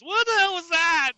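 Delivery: high, loud, slow frightened cry; startled shout. Acted. Samantha to Brittany after hearing the voice, startled shout. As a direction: shriek at the sudden sound.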